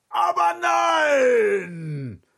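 long indignant lion shout; lion roaring. A narrator's reading. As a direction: roar it as an outraged lion. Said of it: "brüllte der Löwe".

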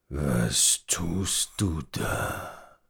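put-on very slow, gruff old man growl. A narrator's reading. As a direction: growl the question at the thief. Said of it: "knurrte der alte Mann". (langsam knurrender alter Mann)

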